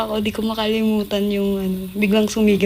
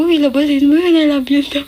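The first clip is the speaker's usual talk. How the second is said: louder, higher mock teasing call; teasing dad; 6 semitones higher, 6 dB louder than usual. Dad teasing his daughter about the boy, loud and playful. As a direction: needle her like a playful dad. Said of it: "inaasar ako. Sabi niya".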